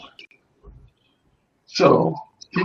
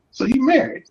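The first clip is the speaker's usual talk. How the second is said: high-pitched retold surprise; raised, incredulous query; surprised question; 10 semitones higher than usual. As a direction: imitate being taken aback, voice up high. Acts surprise at caller having husband.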